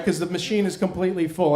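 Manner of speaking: normal talking voice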